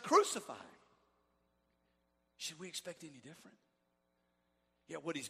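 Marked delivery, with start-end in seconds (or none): 0.0-0.8 loud mocking crowd cry; a loud crowd shout; shouted crowd quote. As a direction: call it out like the jeering mob. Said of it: "and shouted".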